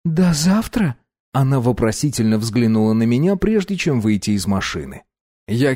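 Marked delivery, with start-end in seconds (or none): 0.1-0.9 put-on light girl voice. Masha asks, high voice.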